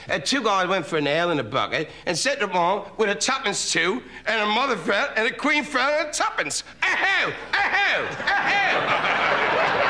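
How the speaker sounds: climbing, high mock-British patter; exaggerated British comics. Comedian parodying British comics' jokes, climbing high-pitched gibberish. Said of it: "this is similar, exaggerating".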